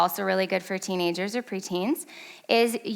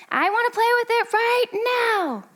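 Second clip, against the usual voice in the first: very high-pitched mock kid tantrum; put-on tantrum voice, held high; imitated yelling child; 12 semitones higher than usual. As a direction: mimic a child wailing a demand. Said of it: "And he's yelling".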